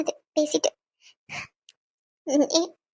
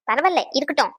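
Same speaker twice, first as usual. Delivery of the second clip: ordinary talk, nothing marked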